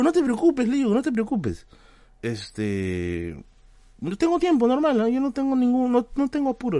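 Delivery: high, eager mock-polite voice; eager reassurance. Streamer telling the driver he's in no hurry, high and eager. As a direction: wave it off brightly.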